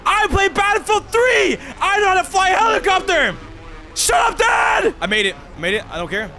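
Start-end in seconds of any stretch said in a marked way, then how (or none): 0.0-4.9 loud, much higher bragging yell; hyped shouting. Real, not acted. Player bragging about Battlefield skills, shouting. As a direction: yell it like a triumphant gamer.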